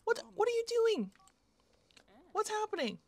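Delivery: high-pitched bewildered questioning; startled confusion. Real, not acted. Player reacting to baffling game events, startled confusion. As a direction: blurt it high, caught off guard.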